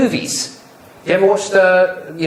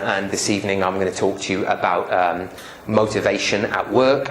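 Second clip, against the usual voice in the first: lower, softer droning presenter intro; dull boring opening; 6 semitones lower, 3 dB quieter than usual. Shown as a demonstration. Trainer showing the audience a boring opening, droning dully. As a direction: drone through a standard self-introduction. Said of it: "somebody comes out and says".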